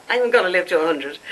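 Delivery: ordinary delivery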